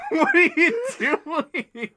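high, slow, laughed-out question; laughing too hard to speak normally; helpless laughter. Real, not acted. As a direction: squeal it through real laughter. Cracking up at mic glitch.

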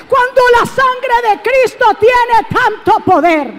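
predicando a plena voz, más alto y fuerte; exhortación encendida a la congregación; predicación. Acted. Preaching at full force. (predicador gritando con fervor)

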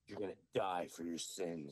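lightly voiced child witch, low and solemn; daughter in character. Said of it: "she goes".

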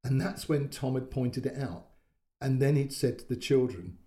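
ordinary delivery, nothing marked

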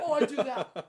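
loud laughing admission. Real, not acted. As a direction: admit it while laughing.

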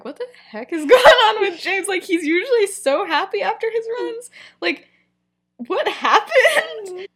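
high, laughing disbelief; baffled. Retold or imitated. The boys wonder about angry James, hammed-up bafflement.

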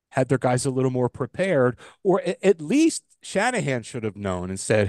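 ordinary delivery

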